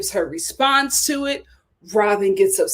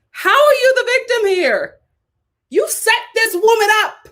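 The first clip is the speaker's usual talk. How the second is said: much higher, louder indignation; outraged disbelief; 10 semitones higher, 3 dB louder than usual. Real, not acted. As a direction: rise high in outrage. Outraged rhetorical peak.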